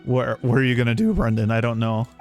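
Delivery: ordinary delivery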